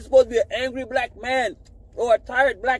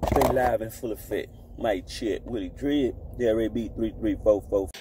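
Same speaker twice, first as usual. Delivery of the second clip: deep, low radio announcer voice; mock radio ad; 11 semitones lower than usual. Plugging his brand as a deep-voiced radio ad. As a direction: read it like a late-night radio spot.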